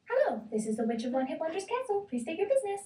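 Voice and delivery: high, bright intercom greeting; a lightly voiced lovely intercom voice. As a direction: sound like a polite receptionist on the intercom. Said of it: "said a lovely voice".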